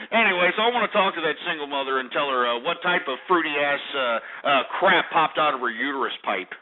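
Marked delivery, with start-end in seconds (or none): none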